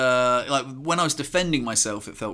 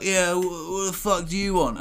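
higher-pitched retold challenge; confrontational drunk; 5 semitones higher than usual. Drunk man caught at the car, confrontational.

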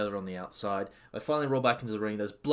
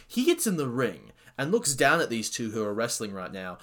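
ordinary talk

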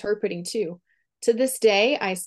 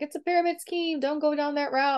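a hint of a warning husband, much higher; mock the husband's warning in a raised voice; imitating husband; 8 semitones higher than usual. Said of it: "my husband was like".

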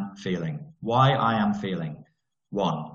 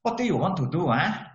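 high, sing-song mock Malaysian English; Malaysian accent; 9 semitones higher than usual. Speaker mimics a Malaysian questioning him in Malaysian accent. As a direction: pitch it up in a Malaysian lilt. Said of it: "Do you like my Malaysian accent".

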